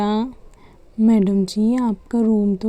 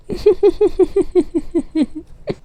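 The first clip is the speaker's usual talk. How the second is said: laugh; 8 semitones higher than usual. Acted. Laugh in other voice.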